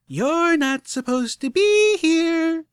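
chirpy robot scolding slowly; mechanical Pinkie Pie. A narrator's reading. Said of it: "sounded disturbingly like a mechanical Pinkie Pie".

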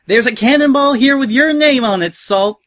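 high, loud threat hollered across to another ship; shrieking-high angry holler; furious yell. A narrator's reading. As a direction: yell it over at him with unrestrained fury. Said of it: "she yelled over at him".

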